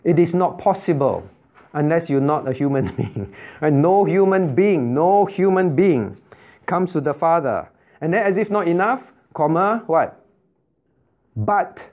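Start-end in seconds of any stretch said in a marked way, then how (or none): 3.8-6.2 slow, insistent "no human being", repeated; emphasis on NO. Shown as a demonstration. Teacher driving the verse home to students, emphatic NO. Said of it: "Can you see the emphasis". 11.4-11.8 "But" set apart by pauses; emphasis on BUT. Shown as a demonstration. Teacher quoting the verse to the class, emphatic BUT. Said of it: "how many times could the Lord emphasize".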